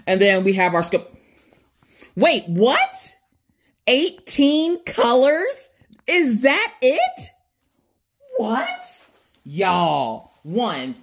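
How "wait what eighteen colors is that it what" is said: high, slow, disbelieving questions; can't believe there are only eighteen; surprised disbelief. Real, not acted.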